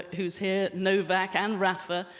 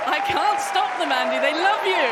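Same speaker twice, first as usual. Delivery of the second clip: shouting; 9 semitones higher, 11 dB louder than usual. Real, not acted. Host over a cheering crowd.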